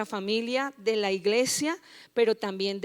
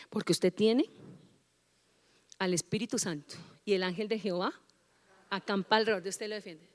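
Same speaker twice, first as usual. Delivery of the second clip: ordinary talk, nothing marked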